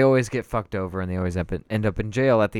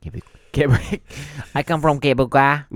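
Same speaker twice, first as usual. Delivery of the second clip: hammed-up French Canadian accent; a jokey Quebec accent. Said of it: "best Canadian accent I've ever seen".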